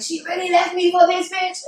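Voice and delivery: high, loud indignant disbelief; outraged high-pitched retort. Retold or imitated. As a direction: replay being mad at getting ditched. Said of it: "I was mad as hell".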